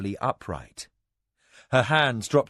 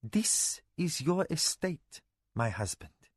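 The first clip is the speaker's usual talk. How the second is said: soft, slow, flat statement stressing your; 5 semitones higher, 6 dB quieter than usual. Acted. Mara coolly reminds her husband of his duty. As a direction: press the point without emotion. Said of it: "said Mara flatly".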